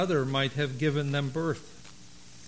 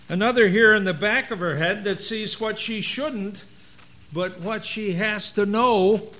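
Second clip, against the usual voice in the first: expressive read-aloud with lively character quotes; story reading; 3 semitones higher, 4 dB louder than usual. Acted. Pastor reading a Mother's Day story to his church, animated. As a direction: read the tale to the congregation, voicing God and angel.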